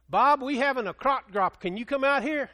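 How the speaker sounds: put-on eager young caller on the line; caller on the phone. Caller voiced in retold story.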